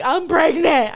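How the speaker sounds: loud, high retold outburst; blurted out. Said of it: "I just straight blurted it out".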